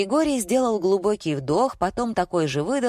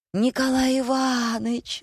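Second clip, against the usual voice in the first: high, drawn-out call; crying out; 6 semitones higher than usual. A narrator's reading. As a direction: sing out the name like a sudden realisation. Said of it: "закричала я".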